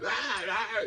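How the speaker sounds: high, flustered yelp; a hammed-up frightened stammer; mock flustered. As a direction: play the panicked guy. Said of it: "didn't handle himself like".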